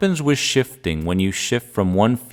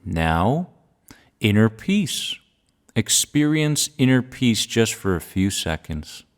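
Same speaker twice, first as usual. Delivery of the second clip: calm grounding exercise. Acted. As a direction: calmly guide each feeling, pausing long. Guides a feeling exercise.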